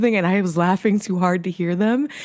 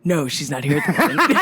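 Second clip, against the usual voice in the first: low phone voice, posing as someone else; deep man's voice; 7 semitones lower than usual. Retold or imitated. Woman hides who she is from caller, deep voice. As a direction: keep it low and businesslike. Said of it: "admit that that was your voice".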